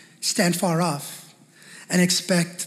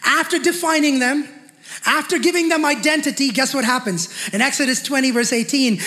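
ordinary talk, nothing marked

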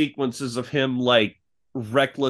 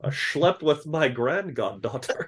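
put-on slow Connery accent; Sean Connery; 3 dB quieter, slower than usual. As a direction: drawl it like Connery. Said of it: "the Connery impressions".

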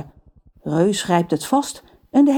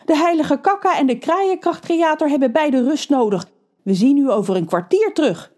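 panicky; 8 semitones higher than usual. A narrator's reading. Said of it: "roept Rons moeder paniekerig".

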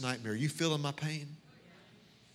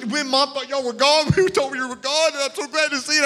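relieved dad; 9 semitones higher, 7 dB louder than usual. Retold or imitated. Dad relieved, hugging girls.

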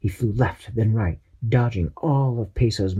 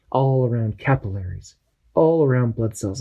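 usual speaking voice

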